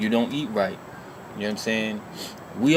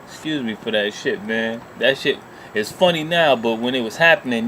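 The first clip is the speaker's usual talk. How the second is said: normal talking voice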